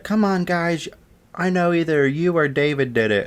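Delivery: high-pitched, whining mock plea; McKenna. Classmate to the two suspects, whiny accusation. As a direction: imitate a classmate pressing for a confession.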